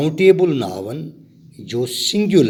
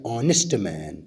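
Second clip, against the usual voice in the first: usual speaking voice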